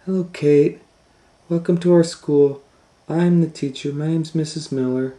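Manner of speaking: bright teacher voice; friendly teacher. Acted. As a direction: greet warmly like a teacher. Kind teacher greets.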